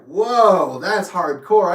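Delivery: retold shock, loud drawn-out whoa; imitated startled disbelief; stunned. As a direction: react taken aback, voice up. Retold shock at being kicked out.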